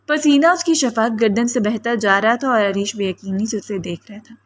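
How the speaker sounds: ordinary delivery, nothing marked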